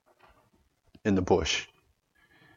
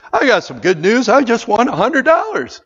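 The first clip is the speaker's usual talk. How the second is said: mock excitement; 14 semitones higher, 10 dB louder than usual. Mock excited good-news example.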